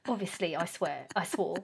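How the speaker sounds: normal talking voice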